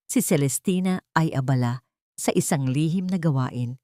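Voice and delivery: ordinary delivery, nothing marked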